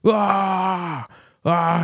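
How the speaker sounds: high, drawn-out "aah" battle yell; long, high-pitched fighter's cry; imitated war cry. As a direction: mimic a little kid's war cry. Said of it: "this kid had war cries".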